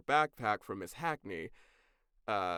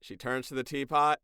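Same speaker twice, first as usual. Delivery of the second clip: ordinary delivery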